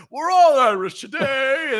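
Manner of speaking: high, loud, slow mock proclamation; mocking Americans. Americans on Saint Patrick's Day, hammed-up high proud cry. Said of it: "they're like".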